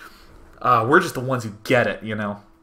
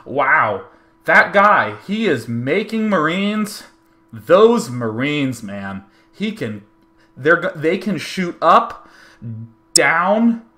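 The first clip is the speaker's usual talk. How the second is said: loud, high, slow mock commentary; clueless commentator; 4 semitones higher, 5 dB louder, slower than usual. Stage commentator who doesn't know StarCraft, hammed-up clueless commentary. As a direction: stretch out each random observation in baffled awe. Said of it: "nonsensical, completely randomly directed commentary".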